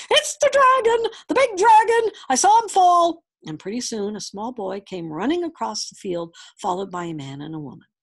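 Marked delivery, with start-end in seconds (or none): 0.1-3.2 high, loud excited shout; piercing cry of alarm and discovery. A narrator's reading. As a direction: yell it across a field. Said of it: "he heard someone shouting".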